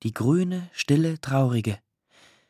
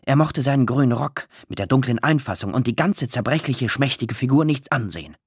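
plain reading voice

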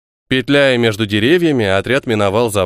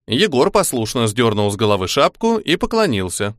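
normal talking voice

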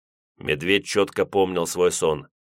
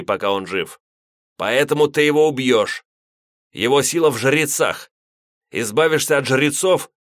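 put-on loud stern old priest giving orders; stern commanding priest; 5 semitones higher, 4 dB louder than usual. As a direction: command him with grim urgency. Priest gives orders, still heated.

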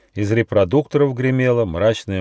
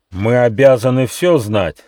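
stern; 4 semitones higher, 7 dB louder than usual. A narrator's reading. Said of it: "строго сказал павлин".

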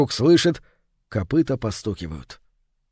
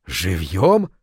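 shocked cry; 7 semitones lower than usual. A narrator's reading. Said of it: "вскричал князь".

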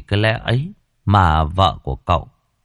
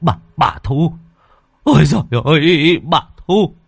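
panicked wailing cry; 6 semitones higher, 4 dB louder than usual. Acted. Villager cries out in panic.

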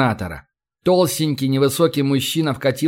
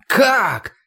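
startled, sharply higher-pitched question; dismayed exclamation; 9 semitones higher than usual. A narrator's reading. Company manager hears the governor cannot help, startled dismay. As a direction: exclaim it in confusion, pitch leaping up. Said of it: "воскликнул в смятении О'Кифф".

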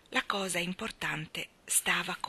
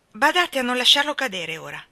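high, loud fretful warning; anxious; 6 semitones higher, 8 dB louder than usual. A narrator's reading. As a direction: fret that it might be dropped. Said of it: "mormorò la signora Brill ansiosamente".

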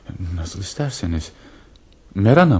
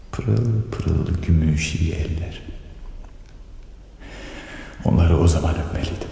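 slow reverie. Acted. Reverie about her hands.

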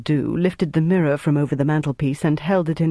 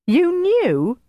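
very high incredulous question; shocked disbelief; 3 dB louder than usual. A narrator's reading. Jelly learning Flick knew Greta's secret, shocked. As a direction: shoot the pitch right up, astonished.

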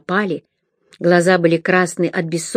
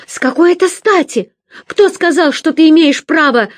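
high, loud indignant challenge; defiant; 10 semitones higher, 5 dB louder than usual. A narrator's reading. Sister defying brother who took the pouch. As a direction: challenge his right furiously.

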